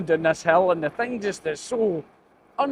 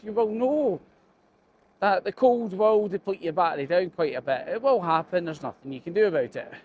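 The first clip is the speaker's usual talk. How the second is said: ordinary talk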